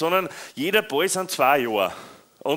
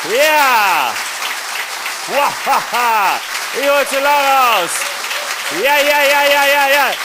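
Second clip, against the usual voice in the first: loud, high whoops; triumphant shouting, much higher; excited cheering; 8 semitones higher, 9 dB louder, slower than usual. Real, not acted. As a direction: whip the crowd up, yelling. Cheering crowd on.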